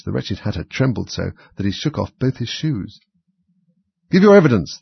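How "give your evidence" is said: high, loud angry demand. A narrator's reading. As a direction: bark the order in anger. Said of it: "the king repeated angrily".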